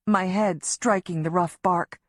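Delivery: plain reading voice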